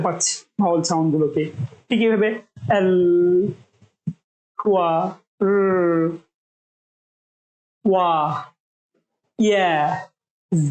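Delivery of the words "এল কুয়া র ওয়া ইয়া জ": each sound held and stretched; continuous sounds. Shown as a demonstration. Teacher showing sounds that can be held long. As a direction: let the sound run on. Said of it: "এগুলো আমরা ইচ্ছে মতো long করতে পারছি".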